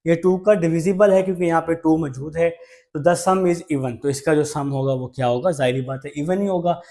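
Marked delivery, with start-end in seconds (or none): none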